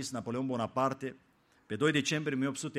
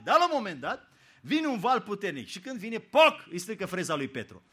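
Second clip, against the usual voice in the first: ordinary talk, nothing marked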